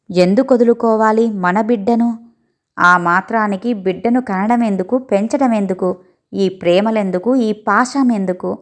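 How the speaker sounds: ordinary delivery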